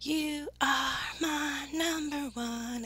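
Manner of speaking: slow, spaced-out YOU ARE MY NUMBER ONE; slowly stretched declaration of love; emphatic affection. Real, not acted. Same words repeated for emphasis.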